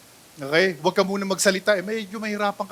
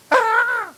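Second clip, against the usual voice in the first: sudden high gasping cry; mock a person being startled; startled yelp; 14 semitones higher, 4 dB louder than usual. Showing how a startled friend yelps, a sudden high cry.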